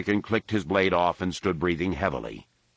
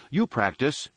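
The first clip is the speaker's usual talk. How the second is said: higher-pitched retort; quick comeback, pitched up; 7 semitones higher than usual. A narrator's reading. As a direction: throw it back at your brother. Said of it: "Anakin retorted".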